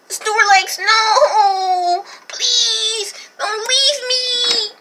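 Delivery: high, wailing plea with sobs; pleading cry. Acted. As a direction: beg him not to go, crying high. Begging Snorlax not to leave.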